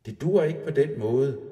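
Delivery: put-on the little nisse boy shouting up at the troll. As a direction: yell it up at the troll. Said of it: "råbte Tjovik".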